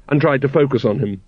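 usual speaking voice

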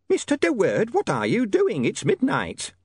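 high, scolding landlady voice; startled landlady in curlers; shrill nagging landlady. Acted. Landlady's voice in story.

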